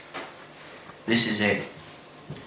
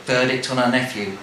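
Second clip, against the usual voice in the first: plain reading voice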